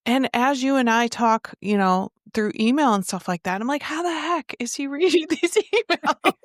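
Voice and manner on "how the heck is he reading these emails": higher, laughing wonder; amused disbelief. Retold or imitated. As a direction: marvel at it with a laugh. Host's amused wonder about blind guest.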